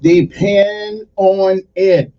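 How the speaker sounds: loud, slow mock announcer echo; echoing the promo. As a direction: ham up the tagline, drawn out. Host echoes promo tagline.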